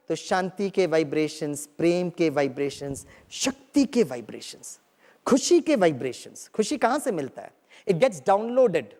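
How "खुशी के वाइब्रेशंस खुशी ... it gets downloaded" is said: ordinary talk, nothing marked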